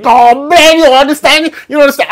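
high, loud imitation of a Barbadian man; Bayesian accent impression. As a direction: press him with a loud Bajan-flavoured question. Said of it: "And the man said".

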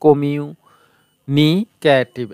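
কমিউনিকেটিভ very slow, with gaps between the parts; split into syllables. Shown as a demonstration. Teacher showing it has four parts, কমিউনিকেটিভ slowly split up. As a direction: stretch out কমিউ, নি, কে, টিভ. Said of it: "এখানে চারটা শব্দাংশ".

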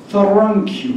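日本語訛りでThankを「サランク」のようにRを入れて引き延ばす; 日本語訛りで余計なRを入れる; 日本語訛りでR入り. Retold or imitated. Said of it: "Rが入ってないのにR入れちゃったりね". (日本語訛りのR入り「サランキュー」)

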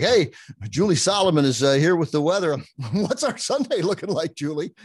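local news anchor impression. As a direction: ham up a TV anchor tossing to the weather girl. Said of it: "this is my local news impersonations, me anchoring".

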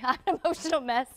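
normal talking voice

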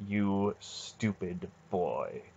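very slow, soft menace; terribly quiet. A narrator's reading. Aunt answering Garion's outburst, terribly quiet. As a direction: draw it out quietly, dangerously. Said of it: "all the more terrible because it was so quiet".

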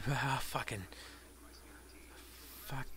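usual speaking voice

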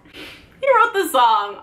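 normal talking voice

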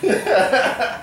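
high-pitched laughing; can't stop laughing. Real, not acted. Still laughing at friend.

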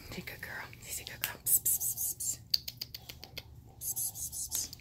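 soft whispered praise. Real, not acted. Owner whispering praise to Cashmere, whisper. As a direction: whisper it gently.

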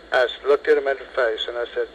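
ordinary delivery

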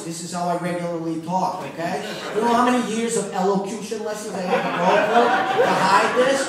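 loud brash New York voice; brash New Yorker, fast and loud; New York accent. Acted. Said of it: "What the fuck is that?".

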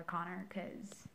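slow voiceless whisper. Real, not acted. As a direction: trail into a whisper.

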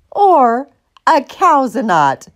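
slow, deliberate cow declaration; measured, emphatic pronouncement; proud declaration. A narrator's reading. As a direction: declare it grandly, drawing out the first word. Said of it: "Martha declared".

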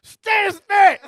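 high, loud mock shout; mock elder. Imitating the elder scolding him, high loud mock shout. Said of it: "And elder was like".

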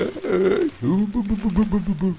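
high, loud babbling alarm; mock alarm call. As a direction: squeal bu bu like a panicked guard. Imitates enemy alerting others. (hohe, laute Alarmrufe)

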